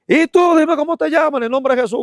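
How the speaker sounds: high, loud command to the spirit; forceful exorcist's challenge, pitched high and loud; commanding rebuke. Retold or imitated. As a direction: call the demon out by name, ringing high. Rebuking a demon in the street.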